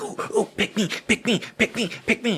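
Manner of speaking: put-on high, eager kid begging; eager little boy. Johnny begging the teacher to call on him, high and eager. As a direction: beg to be picked like an overkeen kid. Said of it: "little Johnny's just like".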